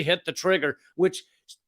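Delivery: usual speaking voice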